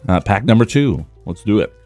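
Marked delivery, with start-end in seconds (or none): none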